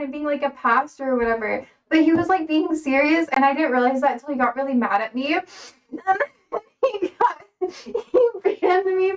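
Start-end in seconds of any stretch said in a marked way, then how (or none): 5.9-9.2 breaking into laughter mid-sentence; giggly, high laugh-talk. Real, not acted. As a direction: laugh through the words. Laughing while retelling the ban.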